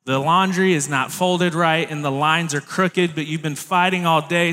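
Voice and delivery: usual speaking voice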